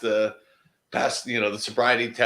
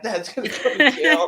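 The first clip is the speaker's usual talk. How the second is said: freaking out; 12 semitones higher, 3 dB louder than usual. Retold or imitated. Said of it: "his son was kinda freaking out".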